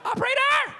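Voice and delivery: very high, loud cry for help; panicked shout. Retold or imitated. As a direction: scream for the operator in panic. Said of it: "You're like".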